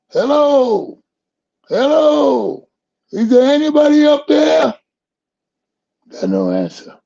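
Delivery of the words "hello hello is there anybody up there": high, loud calls of hello up the mountain; screaming for help. Retold or imitated. Man on a cliff calling out for rescue, loud high shout. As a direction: yell up the cliff for anyone. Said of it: "So he screamed".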